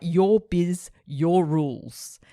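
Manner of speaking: voiced message, slow and forceful; emphatic declaration. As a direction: lay it down like an unbreakable law. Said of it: "it was like".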